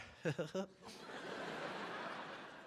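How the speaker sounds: soft, drawn-out mock chuckle; sheepish laugh. Patient caught misreading the eye chart, sheepish laugh. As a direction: chuckle quietly to cover a mistake. Acts nervous laugh at wrong answer.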